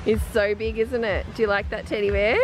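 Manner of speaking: ordinary talk, nothing marked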